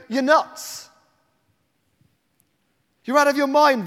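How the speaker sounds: a mock brush-off from the praying friends; scoffing retort. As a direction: scoff her down. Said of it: "and they go".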